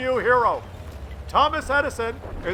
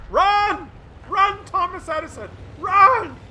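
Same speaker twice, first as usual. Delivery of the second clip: higher, louder urging shouts; urgent cheering; 8 semitones higher, 4 dB louder, slower than usual. Real, not acted. Viewer urging the tank driver to run, shouting. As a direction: yell at the screen to make him flee.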